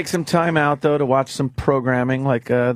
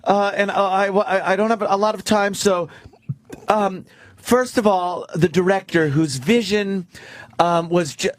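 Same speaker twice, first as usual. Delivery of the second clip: higher, halting award-winner parody; mock acceptance speech; 5 semitones higher than usual. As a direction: stumble through thank-yous with ums and restarts. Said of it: "they are the worst speeches".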